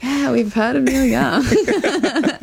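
drawn out and slow, slightly high; mock weary reply. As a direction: play the fed-up listener, laughing through it. Friends' reply to the bore.

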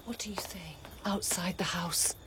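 urgent whisper. Acted. Sister warns Electra to hush.

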